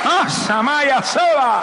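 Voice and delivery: high, very slow ecstatic cry; speaking in tongues. Retold or imitated. Wife receiving the Spirit, high slow tongues. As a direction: let it pour out like tongues.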